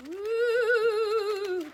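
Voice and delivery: spooky ghostly woo, high and loud. Pretend. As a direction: wail it like a ghost. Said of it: "Don't I have a good ghost voice?".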